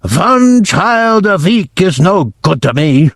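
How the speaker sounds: a tyrant scolding slowly; crying out with a heavy accent; Grand High Witch. Acted. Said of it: "cried out".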